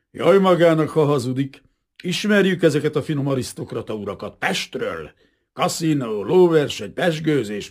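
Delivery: officer ranting at the aristocrat; snarling rant. A narrator's reading. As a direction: keep snarling hatred at him without pause. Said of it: "Egy végtéből acsarkodott".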